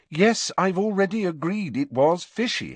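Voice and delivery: high-pitched impatience, dropping on the name; impatient friend conceding a point; a little impatient. A narrator's reading. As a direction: let mild exasperation push the pitch up. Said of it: "a little impatiently".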